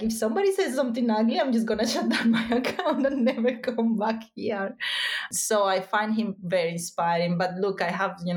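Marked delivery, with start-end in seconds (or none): 0.0-4.8 fast, higher, half-laughing threat to quit; mock dramatic quitting. Guest imitating herself quitting over ugly comments, mock dramatic. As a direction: act out flouncing off social media. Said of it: "I'm like".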